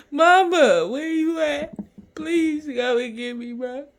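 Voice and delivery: high, pleading cry acted out; imitating his younger self crying for his mom; retold crying plea. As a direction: beg for mama through tears. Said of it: "Just fucking crying and shit".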